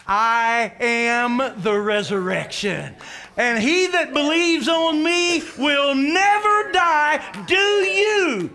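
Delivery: slow, high, full-voiced proclamation; thunderous declaration. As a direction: proclaim it to the whole church, stretching each word. Jesus's declaration preached at full force.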